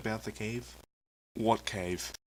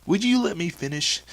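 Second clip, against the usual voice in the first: louder, higher exasperated protest; 7 semitones higher, 9 dB louder than usual. Acted. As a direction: cut off the interrupters with impatience. Host interrupted by repeated guesses.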